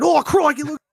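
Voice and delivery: playful mock Australian excitement; Steve Irwin. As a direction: exclaim it like an Australian croc wrangler. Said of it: "pretend I'm like Steve Irwin".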